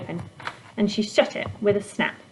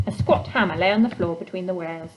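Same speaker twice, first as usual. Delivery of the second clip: plain reading voice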